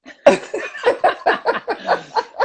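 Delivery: burst of laughter. Real, not acted. Laughing at own story.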